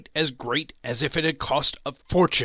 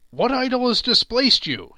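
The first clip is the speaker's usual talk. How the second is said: sharp rejoinder; 11 semitones higher, 8 dB louder than usual. A narrator's reading. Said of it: "he rejoined".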